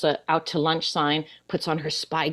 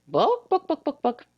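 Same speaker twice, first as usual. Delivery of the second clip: high chicken cluck from the librarian; a mock hen squawk back; 9 semitones higher than usual. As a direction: mimic the chicken's bawk.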